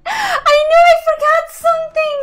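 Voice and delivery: high, loud laughing yell; excited shout. Real, not acted. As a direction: shriek with delighted surprise. Finds forgotten unit, yells.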